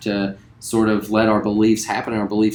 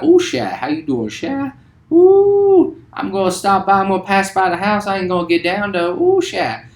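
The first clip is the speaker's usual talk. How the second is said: put-on South Louisiana Cajun accent; 10 semitones higher, 4 dB louder than usual. Teacher imitates South Louisiana neighbours, high Cajun accent. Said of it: "people be like".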